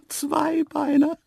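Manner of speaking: high, cheeky giggle around the word; giggling snort. A narrator's reading. Antelope laughing at the two-legged man, mocking. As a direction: burst out giggling in a high animal voice. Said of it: "prustete sie leise".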